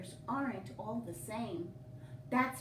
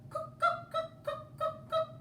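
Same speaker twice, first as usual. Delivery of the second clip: very high, quick cook cook; high chirpy bird call; woodpecker call. Acted. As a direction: peck it out high and clipped.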